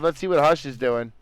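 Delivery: usual speaking voice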